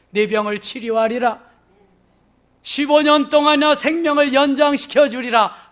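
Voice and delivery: God's promise declared loud and high; booming proclamation. Acted. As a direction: proclaim it with mounting force. Voices God's promise to Hezekiah. (우렁차게 선포하는 말투)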